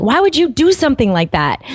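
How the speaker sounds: high-pitched offhand scolding question; mock offhanded telling-off; offhanded parental reproach. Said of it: "some little tiny offhanded thing".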